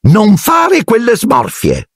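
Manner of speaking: very high, slow scolding; slow high mock thunder; Aunt Marge thundering. A narrator's reading. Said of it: "tuonò zia Marge".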